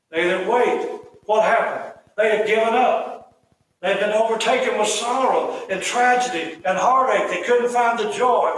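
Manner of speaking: fervent, emphatic sermon delivery; impassioned preaching. Real, not acted. Preaching at raised force.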